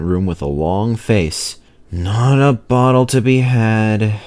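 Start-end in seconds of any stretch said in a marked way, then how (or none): 1.9-4.2 slow, glum moan with an odd voice. A narrator's reading. Driver moaning to Duncan, slow and glum. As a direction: drag it out like bad news. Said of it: "he moaned to Duncan".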